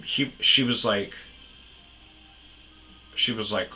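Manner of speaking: ordinary talk, nothing marked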